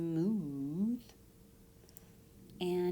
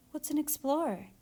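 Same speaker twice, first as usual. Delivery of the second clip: a lightly voiced child, higher; curious little girl; 8 semitones higher than usual. Daughter asking her father about explorers, lightly voiced child. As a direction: ask it like an eager little girl. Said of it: "asked Yasmin".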